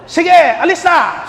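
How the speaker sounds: loud, high, slow call; mock shout at the bus; shooing off. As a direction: wave the bus away loudly.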